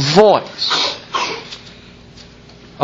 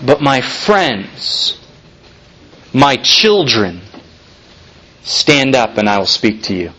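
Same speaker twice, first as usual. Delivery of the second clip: tender and slow; 8 semitones lower than usual. Acted. Preacher voicing Jesus inviting his friends, tender and slow. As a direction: call them close like a loving friend.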